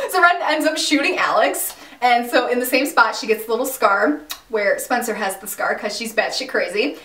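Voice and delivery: normal talking voice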